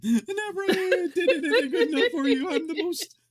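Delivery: mock a mother wailing about being terrible; hammed-up tearful mom voice; imitating mom. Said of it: "you're about as bad as my mom".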